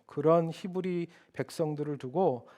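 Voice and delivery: normal talking voice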